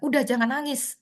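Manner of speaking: mock the wrong way to comfort; dismissive adult. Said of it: "gitu, jangan". (nada meremehkan tangisan anak)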